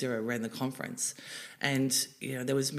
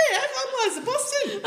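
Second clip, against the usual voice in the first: much higher, laughing through it; laughing reply; 5 dB louder than usual. Retold or imitated. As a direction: introduce yourself while giggling. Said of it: "I'm like".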